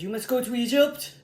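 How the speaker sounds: loud, quick command with a hint of God; lightly voiced commanding God. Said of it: "It was the voice of God".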